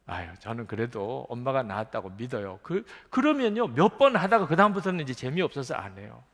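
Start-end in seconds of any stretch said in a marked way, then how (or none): none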